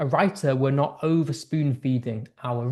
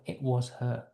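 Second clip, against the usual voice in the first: 1 quiet loaded; 3 semitones lower, 9 dB quieter, slower than usual. Shown as a demonstration. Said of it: "I said".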